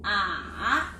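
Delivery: 아 slowly dipping down and back up; third tone. Shown as a demonstration. Teacher showing the dipping tone, slow dip-rise. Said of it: "내려갔다가 올라갔었죠".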